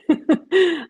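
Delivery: short, high burst of laughter; amused laugh. Real, not acted. Amused by who wrote the comments, high short laugh. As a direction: let out a real giggle.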